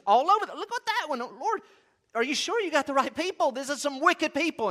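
high-pitched, playful disbelief; mock incredulity. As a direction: question the Lord as if aghast, hammed up. Acts out objection put to God.

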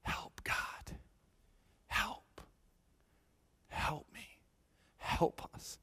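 hushed whisper, long pauses between pleas. Retold or imitated. Pastor voicing a desperate prayer, whispered. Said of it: "and you just say".

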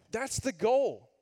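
usual speaking voice

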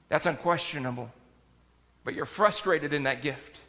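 soft, low pastoral advice; quiet, reassuring pastor; gentle counsel. Retold or imitated. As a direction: counsel them kindly, keeping the voice down. Pastor gently counsels frustrated church member.

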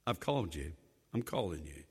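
low, soft voice of God; quiet deep divine voice. Acted. God's voice in retold story.

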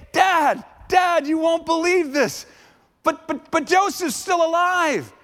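high, urgent shout of amazing news; excited sons. Acted. Sons telling Jacob his boy lives, excited. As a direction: blurt it out high, stammering on the buts.

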